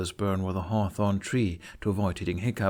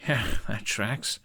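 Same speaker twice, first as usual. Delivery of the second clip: amused laugh. Acted. Hiccup laughs at mother's oddness.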